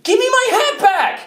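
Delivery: very high, loud demand; outraged shout. Acted. Demanding the hat from Ellie, sudden furious shout. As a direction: explode into a high-pitched yell.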